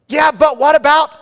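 high, loud pleading whine; whiny protest. Retold or imitated. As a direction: whine like a kid caught out. Arguing back with God.

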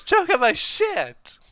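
high, slow, loud screech; shrieked quote. Retold or imitated. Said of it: "that's it, yes".

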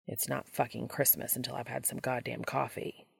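soft, grumbling half-asleep mutter; sleepy, cranky low mumble; grumpy mumble. A narrator's reading. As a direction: grumble into the pillow. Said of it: "Castiel mumbled something".

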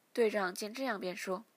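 plain reading voice

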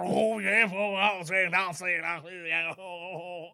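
garbled mock Cajun babble, loud then fading; Cajun impression. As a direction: ramble like a comic Cajun. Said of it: "That's a great impression".

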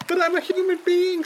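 put-on high pleading child voice. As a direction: plead like a small kid. Drowning child.

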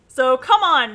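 loud, high, insistent push; urging. A narrator's reading. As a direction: urge friends along forcefully. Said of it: "Nancy urged".

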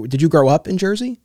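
normal talking voice

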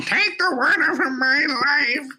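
high, slow hammed-up wail; mock wailing Mapes. Listener mocking the reading, high slow wail. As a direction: imitate the wailing servant for a laugh. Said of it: "you took away all of the intensity".

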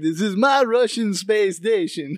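put-on slow, thick Russian accent; Russian accent, drawn-out words; Russian cosmonaut from Armageddon. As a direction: play a movie cosmonaut with a heavy Russian accent. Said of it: "like the guy off of Armageddon".

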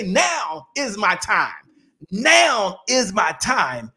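slow, each word weighed; slow deliberate affirmation; slow emphatic mantra. Shown as a demonstration. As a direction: say it slowly like a vow. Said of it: "point to yourself and say".

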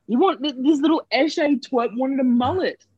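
high sneering mock voice. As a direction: sneer at the little twerp. Mocking the customer.

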